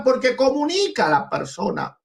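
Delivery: ordinary talk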